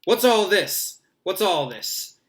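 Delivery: loud, high self-important entrance; big theatrical inquiry; booming. A narrator's reading. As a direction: call out grandly as you stride in. Teacher strides in calling out.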